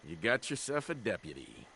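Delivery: playful Wild West lawman voice; hammed-up western twang; put-on cowboy deputy. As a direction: accept the badge like a movie cowboy. Player accepts as cowboy deputy.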